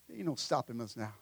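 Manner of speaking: very low, hushed repeat of a lyric; song line. Acted. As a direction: toss the lyric off softly, under the breath. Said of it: "they're probably singing that song".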